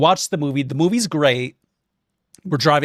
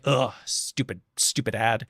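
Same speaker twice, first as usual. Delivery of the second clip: grumpy grumble; 4 semitones lower, 7 dB quieter than usual. Retold or imitated. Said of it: "I'm just kind of grumpy".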